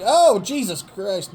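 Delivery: high, loud startled cry; shocked. Real, not acted. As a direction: yelp in surprise. Shock as both men fall outside.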